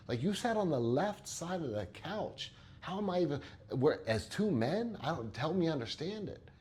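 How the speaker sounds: high, exaggerated incredulous voice; mock disbelief. Therapist teasing client over the couch side, mock incredulous. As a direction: ham up the shock like it's outrageous.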